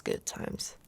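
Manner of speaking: good times trailed off in a soft whisper; whispered wistful repeat of "good times"; whispered echo. Acted. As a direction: echo "good times" softly under your breath.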